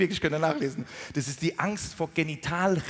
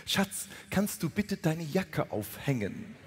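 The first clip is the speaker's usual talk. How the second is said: slow, over-articulated Hochdeutsch wife; pure Standard German; 4 dB quieter, slower than usual. Acted. Wife asking husband to hang up his jacket, slow prim Standard German. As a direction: imitate immaculate Standard German, word by careful word. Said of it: "so im Ast rein Hochdeutsch".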